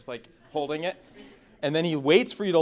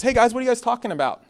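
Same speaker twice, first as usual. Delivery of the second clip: higher, louder, fast casual call to the disciples; Jesus asking; 10 semitones higher, 5 dB louder, faster than usual. Retold or imitated. Jesus calling to his arguing disciples, brisk and raised. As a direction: toss the question out quickly to the group. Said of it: "Jesus turns around and goes".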